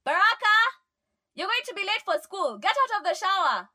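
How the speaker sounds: high, loud call through the house; mother shouting. A narrator's reading. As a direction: yell at your son to hurry. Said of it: "Mom is shouting".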